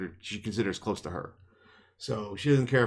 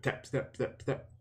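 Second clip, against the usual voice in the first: softer, evenly spaced footstep words; mimicked marching count; 2 semitones higher, 8 dB quieter than usual. Imitating guards turning on patrol, marching rhythm. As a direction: tick off the steps under your breath.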